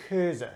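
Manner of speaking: Geuze opening on a guttural kh, ending in uh; Geuze, two syllables, scraped G at the back; Dutch pronunciation. Shown as a demonstration. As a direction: demonstrate Geuze with a throaty Flemish G. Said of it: "this word is pronounced".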